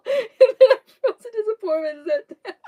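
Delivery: high laughing squeal. Real, not acted. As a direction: talk through a big laugh. Laughing while telling.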